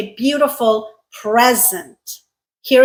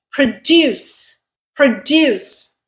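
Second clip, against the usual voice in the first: "produce" as pruh-DUCE; stress on second syllable; 3 semitones higher, slower than usual. Shown as a demonstration. Teacher introduces the verb, "produce" stressed on DUCE. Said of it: "pronounced this way".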